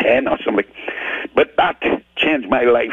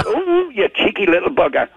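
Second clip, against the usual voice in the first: high-pitched imitation of the lady; mock-scold in a raised voice; lady's playful scolding; 9 semitones higher than usual.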